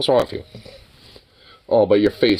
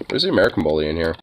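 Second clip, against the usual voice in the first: ordinary talk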